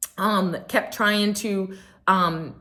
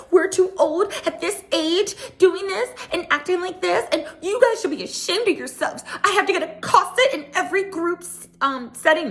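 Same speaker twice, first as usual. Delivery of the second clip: high-pitched, whiny imitation of indignation; hammed-up self-righteous telling-off; mock scolding; 12 semitones higher than usual. Mocking Kyle scolding the women.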